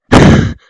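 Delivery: loud blowing noise; imitated resuscitation noise. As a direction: blast out air, imitating resuscitation. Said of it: "the noise it was making sounded like".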